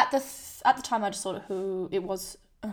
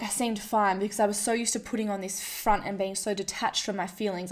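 ordinary delivery

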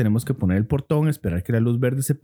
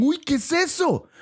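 scared; 14 semitones higher than usual. Retold or imitated. Quoting frightened bystander.

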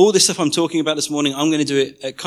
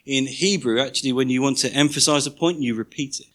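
ordinary delivery

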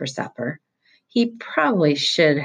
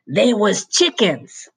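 put-on a higher, louder, drawn-out bellow after a fleeing kid; 7 semitones higher, 4 dB louder, slower than usual. A narrator's reading. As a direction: yell it after the boy riding away. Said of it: "the bald guy bellowed after him".

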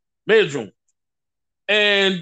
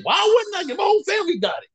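a retold outraged yell, pitched high; incredulous shout; 10 semitones higher, faster than usual. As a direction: fire it back in disbelief. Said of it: "I'm like".

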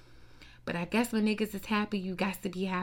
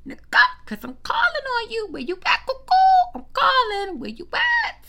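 much higher, louder call, like hollering; shrill calling out; 10 dB louder than usual. Real, not acted. Sister calling out for absent Future, very high and loud. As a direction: holler for him across a field.

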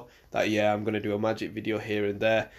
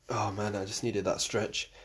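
usual speaking voice

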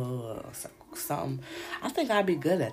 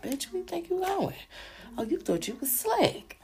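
higher, taunting gotcha voice; mock menacing boyfriend; 12 semitones higher than usual. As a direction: catch her sneaking off and sneer. Crazy boyfriend catches her slipping.

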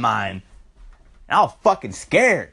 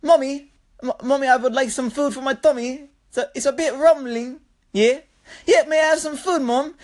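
put-on high, politely pestering Australian child voice; put-on precocious little boy, pitched way up; Stewie; 9 semitones higher than usual. Kid asks Mom for food for his tummy, Stewie-like imitation. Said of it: "or, or Australian Stewie".

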